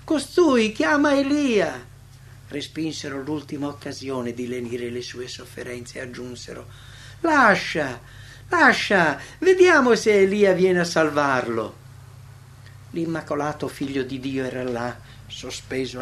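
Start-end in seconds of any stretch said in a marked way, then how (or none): 0.0-1.9 high, loud, drawn-out jeer; scornful mockery. A narrator's reading. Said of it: "con beffe e disprezzo dicevano". 7.2-11.8 loud, scornful call with falling cries; mocking jeer. A narrator's reading. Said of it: "con beffe e disprezzo dicevano".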